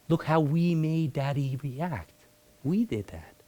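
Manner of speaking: slow, a hint of guilty children; lightly voiced kids. Kids blaming themselves for dad's anger, lightly voiced. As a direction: whisper it like a scared child.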